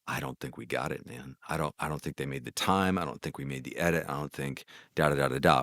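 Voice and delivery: low, very soft, deflated musing; hushed self-doubt. Retold or imitated. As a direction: drop right down as if doubting yourself into the silence. Voicing doubts after ad met silence.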